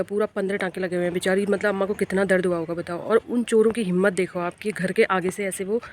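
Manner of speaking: ordinary talk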